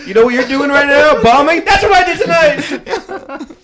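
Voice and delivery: high, loud laughing rant; manic mock outburst. To the woman in bed, a high laughing bombing rant. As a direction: squeal it like a comic losing it. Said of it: "could have been like to her".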